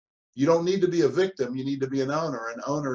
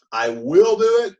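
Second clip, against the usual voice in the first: emphatic resolve; 8 semitones higher, 9 dB louder than usual. Shown as a demonstration. Owner's resolve, acted.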